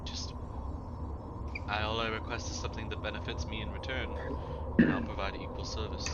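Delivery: soft, understated dryad voice; composed dryad. Acted. Dryad offers the adventurer a trade, soft and composed. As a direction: softly set out your terms as the dryad.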